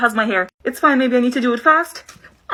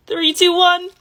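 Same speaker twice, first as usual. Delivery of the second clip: nervous countdown; 7 semitones higher, slower than usual. Real, not acted. Bracing before painful pull.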